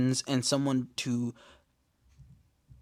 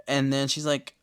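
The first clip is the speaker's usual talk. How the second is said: normal talking voice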